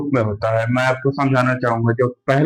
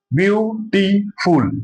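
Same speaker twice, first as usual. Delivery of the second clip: Beautiful drawn out slowly, each syllable separated; 5 semitones higher, much slower than usual. Shown as a demonstration. Teacher counting syllables for his students, slow syllable by syllable. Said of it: "इसमें तीन syllable आ रहे हैं".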